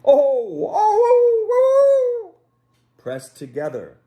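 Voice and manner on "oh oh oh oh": high, loud drawn-out howl; long rising dog howl. Acted. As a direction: howl like a dog to scare the flock together. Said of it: "made the sound of a dog howling".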